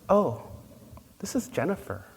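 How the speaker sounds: high-pitched, lightly acted correction; mild surprise. Mother corrects a stranger about her toddler, mildly surprised.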